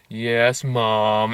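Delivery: low, soft, sheepish reply; subdued mock-obedient mumble; obedient ghosts. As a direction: answer meekly, like a scolded child. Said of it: "all the evil ghosts go like".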